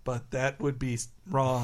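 low, soft deadpan cartoon impression; Corn-Fed. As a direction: read it out low and quiet. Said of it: "Corn-Fed is handed a piece of paper like".

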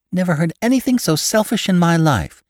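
high, indignant wife. A narrator's reading. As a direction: protest indignantly, pitch right up. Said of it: "in slightly raised voices".

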